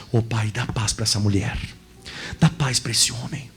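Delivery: oração mais grave, calma e contida; prayer. Acted. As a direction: ore sobre a congregação, devagar. Pastor prays over the congregation. (pastor ora pedindo paz, voz mais grave)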